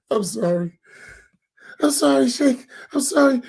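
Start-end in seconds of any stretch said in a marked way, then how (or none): 1.8-3.4 groveling apology. Retold or imitated. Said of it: "imitating the apologizing host".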